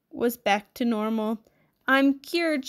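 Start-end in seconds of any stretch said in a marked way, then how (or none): 1.9-2.7 higher, mildly raised joyful shout; lightly acted cry of joy. A narrator's reading. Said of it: "she shouted".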